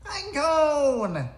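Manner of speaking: high, drawn-out excited cry; excited call. Acted. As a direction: call out the name with glee.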